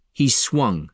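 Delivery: plain reading voice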